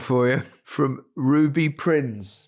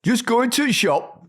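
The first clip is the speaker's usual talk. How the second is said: higher, punchy Yorkshire copy; aggressive Yorkshire; 9 semitones higher than usual. Retold or imitated. Host copies Micah's Yorkshire too hard, aggressive Yorkshire. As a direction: bark it in mock Yorkshire. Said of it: "Why so aggressive?".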